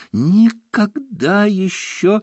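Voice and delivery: high, loud, slow wail; high sobbing wail. Acted. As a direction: wail it out, voice soaring. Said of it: "голосил он".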